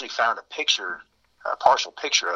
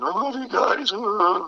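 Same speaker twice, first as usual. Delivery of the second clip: put-on much higher, wailing drunk sob; mock bawling; 11 semitones higher, slower than usual. Mocking the dumped friend wailing to his buddies. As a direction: imitate a heartbroken friend blubbering. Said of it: "He's bawling".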